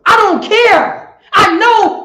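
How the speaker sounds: slow loud preacher cry; loud slow shout. Acted. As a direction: drag it out, loud and high. Voicing oppressor, sermon.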